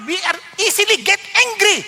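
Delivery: usual speaking voice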